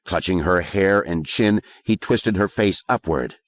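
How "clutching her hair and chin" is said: ordinary delivery, nothing marked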